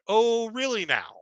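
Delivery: teasing the rival after his slip; sarcastic really. Retold or imitated. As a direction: stretch it out, amused and sly. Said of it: "And I went".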